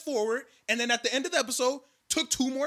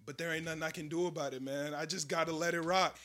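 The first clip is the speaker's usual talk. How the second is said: soft, low, defeated; mock hopeless sigh, much softer; resigned shrug; 8 semitones lower, 10 dB quieter than usual. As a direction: mumble it like giving up. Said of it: "And he'd be like".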